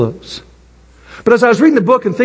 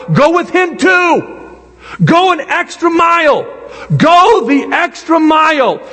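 slow preaching emphasis; 6 semitones higher, slower than usual. Acted. Preaching the command.

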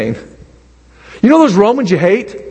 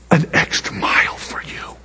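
whispered offer; 7 semitones lower, 8 dB quieter than usual. Pretend. Whispered end of quote.

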